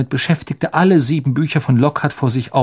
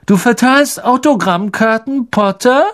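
high, mocking bully voice; sneering schoolboy bully; 11 semitones higher, 4 dB louder than usual. Malfoy jeering at Harry over autographs, high and mocking. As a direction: jeer at him like a spoiled rich boy.

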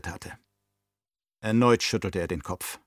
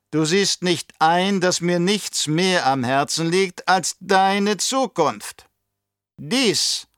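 put-on higher, louder, oily old Baron voice; scheming old Baron; 6 semitones higher, 5 dB louder, slower than usual. Baron to his nephew, raised and sly. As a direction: play the cunning uncle sounding out his nephew.

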